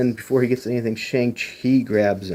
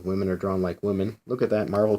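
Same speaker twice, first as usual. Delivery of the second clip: ordinary delivery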